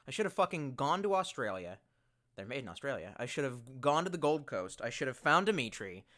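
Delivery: usual speaking voice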